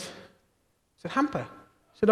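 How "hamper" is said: a high, puzzled echo of the word; surprised. Retold or imitated. Surprised father at the door, high and puzzled. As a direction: caught off guard at the door.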